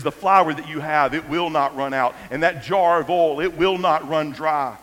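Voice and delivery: loud, firm prophetic promise; a touch of solemn authority; bold promise. Retold or imitated. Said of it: "he makes a bold statement".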